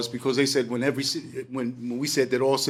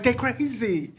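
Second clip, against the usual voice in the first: put-on young self amazed; 9 semitones higher, slower than usual. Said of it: "And I was like".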